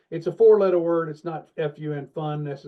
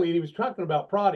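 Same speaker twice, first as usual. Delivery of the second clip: ordinary talk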